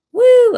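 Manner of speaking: high, loud whoop to shake off the sadness; release whoop. Real, not acted. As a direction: let out a big cheering woo. Shaking off emotion before reading.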